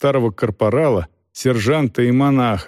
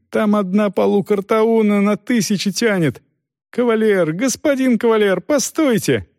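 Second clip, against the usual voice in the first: captain yelling; 10 semitones higher than usual. A narrator's reading. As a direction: wail at their folly in a high voice. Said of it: "как капитан орёт".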